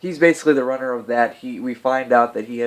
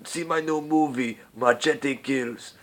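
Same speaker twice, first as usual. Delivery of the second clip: Danny Trejo impression; 3 semitones higher, slower than usual. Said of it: "I can't do Danny Trejo".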